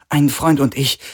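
high, irritated woman's voice; quick, annoyed. A narrator's reading. Woman fed up with the prisoner's questions, annoyed and high. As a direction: brush off his question with annoyance. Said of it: "sagte sie schnell, hörbar genervt von seinen Fragen".

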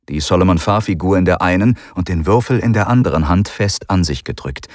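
ordinary delivery, nothing marked

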